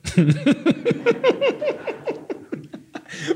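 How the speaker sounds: high burst of laughter; laughing. Real, not acted. Laughing at the story.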